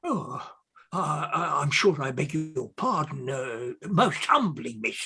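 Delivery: low, soft, halting humility; abashed apology. Acted. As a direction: stammer out a humble pardon.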